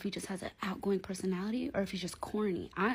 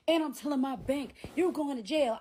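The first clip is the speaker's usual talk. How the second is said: raised, higher arguing voice; imitated angry threat; 6 semitones higher, 5 dB louder than usual. Imitating him threatening her over the bag, angry and raised. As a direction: build up the mock threat, loud. Acted phone quarrel, his threat.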